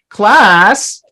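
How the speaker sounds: loud, high call to the class. Pretend. Calls out to pretend class.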